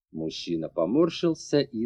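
ordinary delivery, nothing marked

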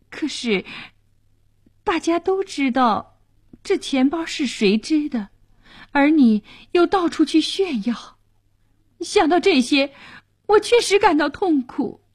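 high, distressed wife's voice; pained pleading. A narrator's reading. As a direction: plead with hurt, pitched well up. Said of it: "马蒂尔德哀求他别这样离开自己". (痛苦地哀求)

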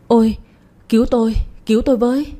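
desperate gasping cry for help. Acted. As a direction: call out for help as the knife goes in. Stabbed, crying out for help.

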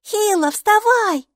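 put-on high, bright child's voice, chattering; a cheerful little girl greeting her strange friend; little girl Maiki. Майки, маленькая девочка, радостно болтает с Букой.

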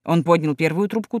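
plain reading voice

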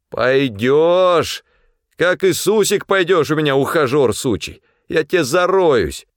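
high, forceful bullying; furious threat. A narrator's reading. Pavel forcing the resisting supply man up, furious threat. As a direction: threaten him as you haul him up.

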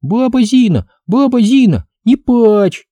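put-on very high, urgent child's voice; little girl crying out. A narrator's reading. Little girl clinging to weeping Baba Zina, pleading cry. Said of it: "вцепилась в штаны с криком".

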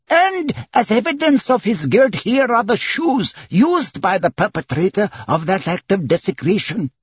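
high-pitched, emphatic clerk voice; officious court clerk. Acted. Clerk presents the evidence to the court, officious.

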